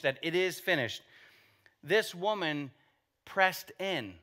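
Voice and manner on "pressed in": normal talking voice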